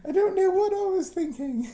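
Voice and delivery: very high, hammed-up tearful British voice; mock British accent. Child confessing to the British lady, mock-crying British accent. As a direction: plead in a squeaky put-on British accent. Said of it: "That was my little British accent".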